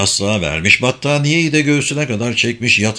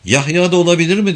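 ordinary delivery

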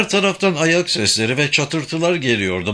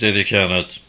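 usual speaking voice

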